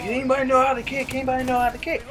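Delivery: high, fast call to the bench; mock coach shouting for a kicker; imitating the coach asking around. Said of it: "he's like".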